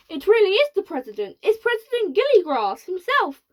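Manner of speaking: high, loud and fast; astonished. Acted. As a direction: gasp in disbelief. Shanks astonished.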